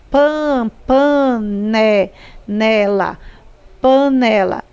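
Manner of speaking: "panela" sounded out in pieces, pan, pané, nela, with "né" held; stress on NÉ. Shown as a demonstration. Teacher helping pupils find the strong syllable, "panela" stressed on "né". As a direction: break up "panela" and lean on "né". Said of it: "Né é mais forte".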